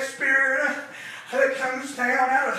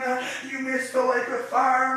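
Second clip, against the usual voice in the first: ordinary talk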